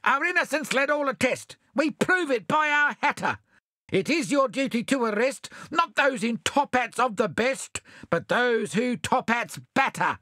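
smug pudding thieves reciting their defence; sing-song thieves. Acted. Thieves to the constable, smug sing-song.

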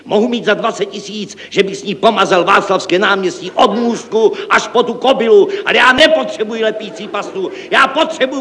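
high-pitched frantic tirade; hysterical ranting. Acted. Director rejects glue paste, ranting hysterically. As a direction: keep the pitch far up, raving. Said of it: "volal hystericky".

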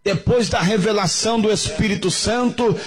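high, ringing preacher proclamation; preaching. Acted. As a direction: announce the miracle like a preacher. Preacher proclaiming over music. (proclamação de pregador, aguda e vibrante)

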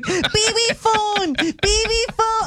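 put-on high, slow little-kid chant; toddler voice. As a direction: copy a toddler demanding the phone, high and slow. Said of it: "Mav was like".

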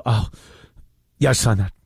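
low, offhand mock governor, lightly voiced; mock careless governor. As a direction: shrug it off like it's nothing. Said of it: "like, hide her head and go".

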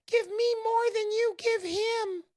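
high squeaky greedy little voice. Retold or imitated. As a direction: demand it like a tiny grasping man. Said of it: "the little voice says".

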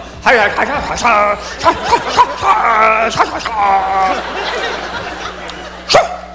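goofy bursts of wordless noise; hammed-up breathy ha-sha noises; imitated kid's silly noises. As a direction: imitate a child's sudden silly outburst. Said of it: "Dex will come in the kitchen and just go".

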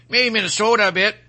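ordinary talk

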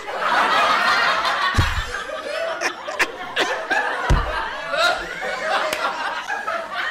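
long high cackle of disbelief; high mocking laugh. Real, not acted. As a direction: laugh out at the absurdity. Laughs at the contradiction.

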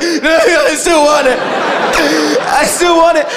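put-on laughing pleading kid voice; cling to it like an addict; a hint of a desperate kid. Brother refusing to give up the cereal, mildly voiced desperate plea. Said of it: "He was like".

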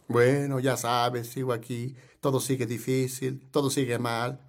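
low, soft mock gloom; downcast complainer. Congregant answering how are you, mock gloom. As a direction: sag into a quiet, resigned imitation.

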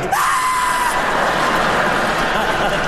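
very high, long held squeal; exasperated scream. Acted. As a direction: let out a sustained high-pitched shriek. Customer's exasperated outburst.

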